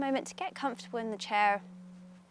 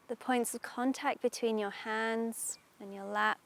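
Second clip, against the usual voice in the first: guided meditation; 3 dB louder than usual. Acted. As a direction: ease them into calm, unhurried.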